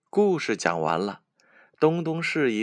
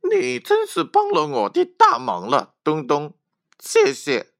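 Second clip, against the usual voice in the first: put-on very high, loud, delighted child's voice; happy thanks; 13 semitones higher, 7 dB louder than usual. A narrator's reading. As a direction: squeal your thanks with joy. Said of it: "西西高兴地说". (尖高又响亮的孩子声音，高兴地道谢)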